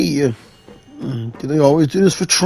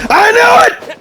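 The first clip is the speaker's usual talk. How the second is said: triumphant shout. Real, not acted. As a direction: yell it like you called it all along. Trap springs in fitting room.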